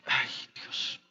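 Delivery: a scared whispered "ay, Dios"; frightened whisper. Retold or imitated. Woman receiving a court notice, frightened whisper. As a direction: breathe it out in sudden fear.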